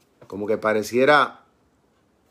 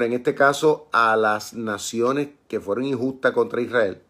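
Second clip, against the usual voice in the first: normal talking voice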